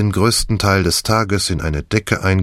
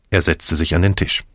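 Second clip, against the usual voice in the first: usual speaking voice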